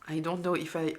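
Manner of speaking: ordinary talk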